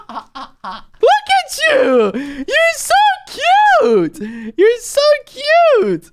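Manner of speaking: very high, slow cooing; cutesy voice. Real, not acted. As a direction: coo over something tiny. Seeing tiny king.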